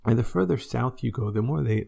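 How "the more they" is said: ordinary talk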